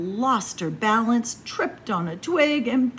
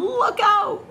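higher and louder warning; crying out; 11 semitones higher, 5 dB louder than usual. Acted. Robert Rabbit warning everyone, high and loud. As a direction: warn them, fast. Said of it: "Robert Rabbit cried".

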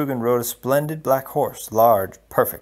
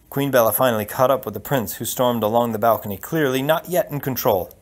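usual speaking voice

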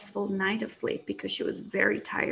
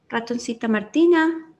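louder, longer call of the name; 8 dB louder than usual. Acted. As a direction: call out into the empty house. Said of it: "she called out".

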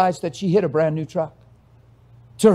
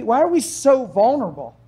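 ordinary talk

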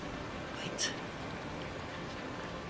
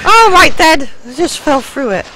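loud exclamation; 9 semitones higher, 12 dB louder than usual. Real, not acted. Startled fall in game.

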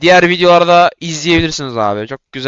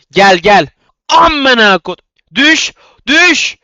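high, loud furious yell of fall, fall; furious cursing; 9 semitones higher, 7 dB louder, much slower than usual. Real, not acted. Gamer raging as two opponents gang up on him, furious yell. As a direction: curse them down in rage.

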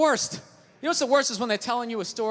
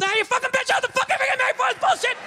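much higher, fast hammed-up rage; mock outburst; 12 semitones higher, faster than usual. Dad snapping at the family over salt, hammed-up rage. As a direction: explode like a fed-up dad.

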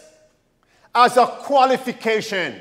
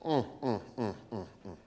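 disapproving hum; 11 semitones lower, 18 dB quieter, much faster than usual. Real, not acted.

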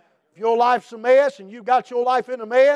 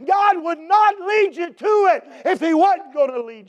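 preaching shout; 3 semitones higher than usual. Acted. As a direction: declare it with full force.

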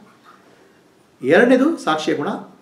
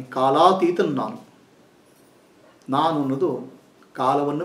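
soft, slow meditation voice with long pauses; calm meditation guidance, softer and lower; guided meditation; 6 semitones lower, 6 dB quieter than usual. Acted. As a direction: guide them inward, gently and unhurried.

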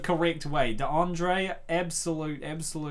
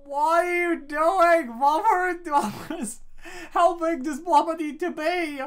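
incredulous outcry; 6 dB louder than usual. Real, not acted. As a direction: protest at how big she is. Reacting to huge Luma.

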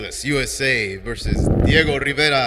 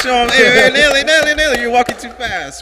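high loud calling across a room; 11 semitones higher, 9 dB louder, faster than usual. Real, not acted. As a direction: shout her name to the stage. Calling out to walking winner.

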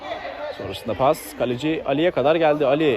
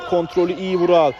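ordinary talk, nothing marked

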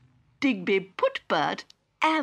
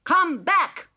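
high, loud robot shout; 11 semitones higher, 6 dB louder than usual. Acted. Digby calls to the walking flower, high loud shout. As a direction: call it out across the field. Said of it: "shouted Digby".

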